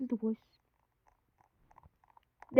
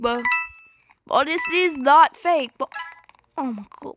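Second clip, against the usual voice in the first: ordinary delivery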